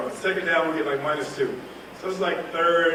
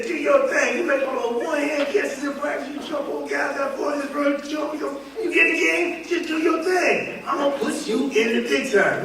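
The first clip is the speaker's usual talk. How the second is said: higher, laid-back cool guy imitation; cool Atlanta quarterback; 9 semitones higher than usual. Quarterback Stanley telling his receiver to do his thing, cool imitation. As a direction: do the smooth confident quarterback, pitched up. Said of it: "Talk like this".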